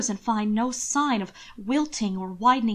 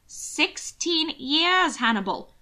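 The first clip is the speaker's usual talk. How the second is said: higher, louder scolding aunt; complaining old aunt; 5 semitones higher, 4 dB louder than usual. Acted. Aunt Grace scolds Hannibal, high and loud. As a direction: nag him for his lost years.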